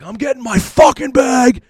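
slow, high, loud retold shout; re-enacted furious yell; temper flaring. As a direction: bellow it, drawing out each word. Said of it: "I have a temper if I'm annoyed".

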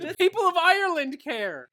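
loud, high-pitched protest; indignant outburst. Real, not acted. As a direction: shout it on behalf of a whole nation. Heated comic argument over accent.

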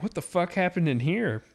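high shocked demand; shocked wife. Retold or imitated. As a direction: play the startled wife walking in. Wife discovering the mess.

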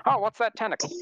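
high, innocent fifties voice; 1950s gee-whiz voice. Retold or imitated. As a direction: naive fifties townsfolk meeting a monster. Joking fifties character voices.

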